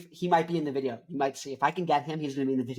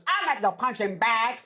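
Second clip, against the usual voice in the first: higher put-on old lady voice; scrappy grandma, higher; imitating grandma; 7 semitones higher than usual. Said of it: "That's what she sounds like".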